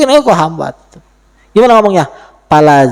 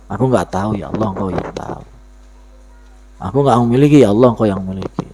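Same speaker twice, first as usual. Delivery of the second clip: hushed prayer of surrender; humble prayer; 5 semitones lower than usual. Acted.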